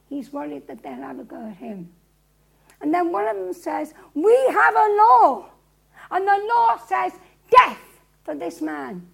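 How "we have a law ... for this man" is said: loud, high, declamatory pronouncement; forceful accusation. Acted. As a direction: proclaim the verdict to the governor. Crowd member confronts Pilate with law.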